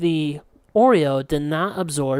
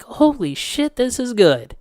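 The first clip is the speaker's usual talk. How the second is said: high-pitched delighted discovery; amazed exclamation; 8 semitones higher, slower than usual. Retold or imitated. Retelling first taste of almond milk, amazed. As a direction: marvel at it, pitch leaping up.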